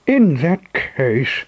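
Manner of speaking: proud cry. Acted. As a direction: rise up and declare it proudly. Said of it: "cried Pinocchio proudly".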